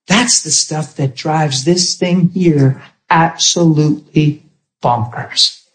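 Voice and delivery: normal talking voice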